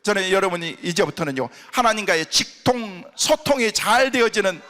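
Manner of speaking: a fervent, loud blessing over the congregation; preaching blessing. Acted. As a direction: proclaim it at full force from the pulpit.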